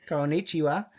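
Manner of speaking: put-on thick Australian accent on Japanese; broad Australian. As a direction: greet like a broad Aussie tourist. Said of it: "thickest Australian accent that he can".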